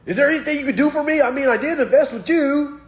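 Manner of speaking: high, fast, whiny begging; mock pleading student. Failed student pleading with the teacher, high and fast.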